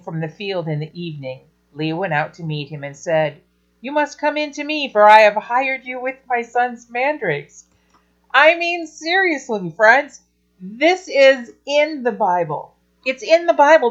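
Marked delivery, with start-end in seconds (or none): 3.8-7.6 voiced Leah, higher and quick, then pushing on; a wife claiming her husband, insistent; Leah's demand. Reading Leah as she tells Jacob to come, insistent higher voice. 8.3-13.9 higher, incredulous, can-you-believe-it; amused disbelief. Real, not acted. Teacher marvelling to friends at the verse, amused disbelief.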